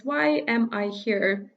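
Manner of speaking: ordinary delivery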